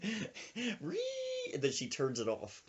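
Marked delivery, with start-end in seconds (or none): 0.0-0.8 rapid-fire falsetto dare; vengeful old woman. Retold or imitated. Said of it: "She's like". 0.8-1.5 very high whining motor noise; vocal sound effect, high whine; imitated leaf blower.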